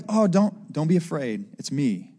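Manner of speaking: low, soft fatherly comfort; gentle father calming a child; loving reassurance. Acted. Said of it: "it's this loving, like, father to a son".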